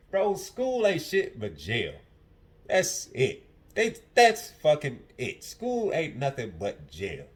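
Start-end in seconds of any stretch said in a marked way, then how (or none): 0.1-6.2 slow emphatic rant. Real, not acted. Ranting about school.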